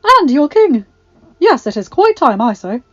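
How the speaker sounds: put-on high, loud, eager old woman; earnest. A narrator's reading. Agatha turns to Jacqueline, earnest. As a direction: exclaim it with urgency. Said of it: "she said earnestly".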